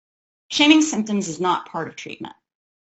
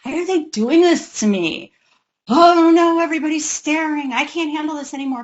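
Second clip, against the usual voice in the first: starts mild, builds to a high, loud "Oh no"; escalating parental panic; 7 semitones higher, 4 dB louder than usual. Retold or imitated. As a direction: climb from mild annoyance into frantic panic. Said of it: "their feelings, as the crisis escalates, go from".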